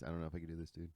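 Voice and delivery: low, hushed retold confession; dead serious. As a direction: confide it quietly, as if taken aside. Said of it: "He's dead serious".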